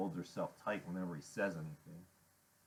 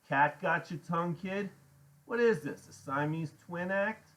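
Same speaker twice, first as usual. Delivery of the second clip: higher, louder biker jeer; mocking taunt; 8 semitones higher, 5 dB louder than usual. A narrator's reading. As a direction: needle the kid loudly. Iggy taunts the kid.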